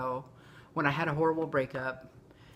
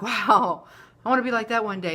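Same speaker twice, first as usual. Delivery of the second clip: loud burst of admiring wow; amazed admiration; 3 semitones higher, 7 dB louder than usual. Retold or imitated. Fan gushing over her YouTube heroes, excited and louder. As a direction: gush like a starstruck fan. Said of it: "I'm like".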